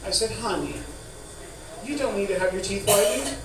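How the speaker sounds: ordinary talk, nothing marked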